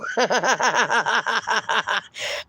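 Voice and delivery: rapid, rolling chuckle; amused run of giggles; real laughter. Real, not acted. Laughs at the dad story.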